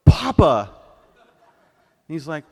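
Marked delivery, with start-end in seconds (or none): 0.1-0.7 one very loud, scolding outburst; acted shocked reprimand at full volume; scandalized shout. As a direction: call him out, appalled. Daughter scolds dad's rude remark.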